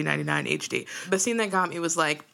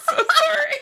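high, loud giggling apology; laughing; 4 dB louder than usual. Real, not acted. Cracking up after singing for her co-host, high giggles. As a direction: laugh through the sorry.

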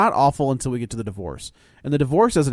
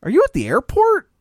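high, louder mock disbelief; incredulous question; 12 semitones higher, 5 dB louder than usual. Husband realises his wife is at the airport, incredulous. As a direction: imitate a stunned husband, pitch leaping very high.

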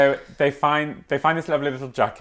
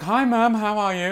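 high sing-out phone hello; retold hello to Mum, pitched well up; cheery greeting; 9 semitones higher than usual.

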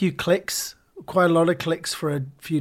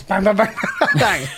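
gunshot noises; 4 semitones higher, 4 dB louder than usual. Retold or imitated. Gunshot sounds in retold scene.

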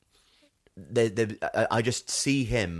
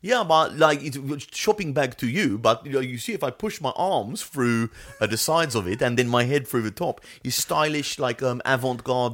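high, breezy Alsace French accent, pretending to know fashion; a French driver at Fashion Week in a put-on Alsace accent; 5 semitones higher, 4 dB louder than usual. Esteban faking expertise at Fashion Week, Alsace French accent. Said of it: "given him an Alsace French accent".

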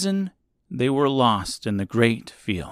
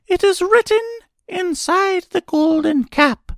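very high, squeaky little voice; reply in a tiny mouse squeak; mouse queen; 3 dB louder than usual. A narrator's reading. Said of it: "replied the Queen of the Mice".

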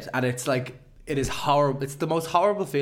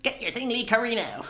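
ad voice; 9 semitones higher than usual. Pretend. Making up a sponsor ad.

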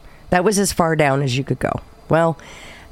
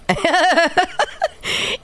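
high laugh; 14 semitones higher than usual. Real, not acted. Burst of laughter.

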